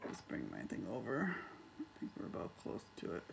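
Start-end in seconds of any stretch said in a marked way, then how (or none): none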